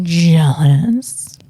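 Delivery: whispered, low and teasing. Pretend. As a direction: breathe it out to tease them. Teasing viewers about his stock.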